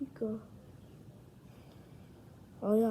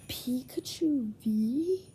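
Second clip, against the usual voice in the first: thrilled; 5 semitones higher, 6 dB louder, slower than usual. Real, not acted. Sees Pikachu V card.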